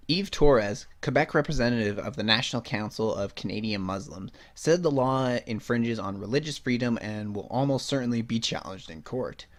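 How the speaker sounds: ordinary talk, nothing marked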